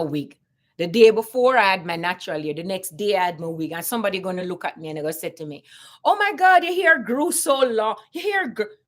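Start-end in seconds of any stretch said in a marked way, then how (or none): 6.0-8.8 high-pitched imitation of gushing amazement; exaggerated, put-on astonishment; mock gushing. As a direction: gush in mock surprise, high and loud at the start. Mocking a coworker's gushing remark.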